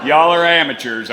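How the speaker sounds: laughing teasing call; laughing tease. Real, not acted. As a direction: needle the crowd with a laugh. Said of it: "teasing the crowd, laughing".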